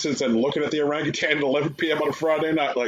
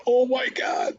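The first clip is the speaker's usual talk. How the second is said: squeaky disbelief; 12 semitones higher, slower than usual. Retold or imitated. Mock reaction to the pitch.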